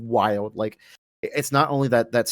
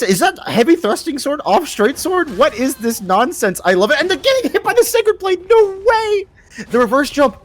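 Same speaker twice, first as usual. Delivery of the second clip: excited; 6 dB louder than usual. Real, not acted. Hype caster reacting to surprise play.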